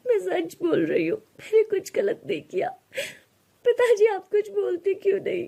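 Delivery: put-on high, crying girl pleading innocence; tearful pleading. Radha begs her father to defend her, high and crying. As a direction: beg through tears to be believed.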